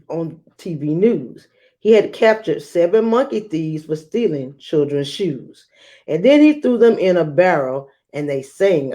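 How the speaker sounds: put-on lively read-aloud for children; storybook reading voice; story narration. A narrator's reading. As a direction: read it like a picture book to a class.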